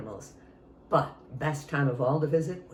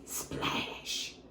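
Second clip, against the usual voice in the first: whispered splash; 9 dB quieter than usual. Acted. Pumpkin falls into lake.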